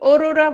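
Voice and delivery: অরোরা wrongly begun with closed o, "orora"; wrong closed vowel. Shown as a demonstration. Teacher shows students what we don't say, অরোরা as "orora". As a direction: round the first vowel of অরোরা like ও. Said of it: "অরোরা বলি না".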